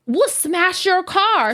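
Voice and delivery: put-on high, slow child's voice shouting threats; kid voice. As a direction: mimic a little girl cussing. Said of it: "Peas is in the back".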